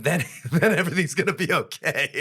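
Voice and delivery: high, loud reassurance through laughter; laughing reassurance. Retold or imitated. Jokes after the stern warning.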